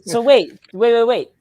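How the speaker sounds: loud. Real, not acted. Pretends shock at the joke.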